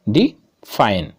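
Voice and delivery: "Define" split in two, a pause after "डि"; syllable by syllable. Shown as a demonstration. Teacher showing students two syllables, "define" slowly split apart. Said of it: "कितने खंडों में हम किसी शब्द को उच्चारित करते हैं".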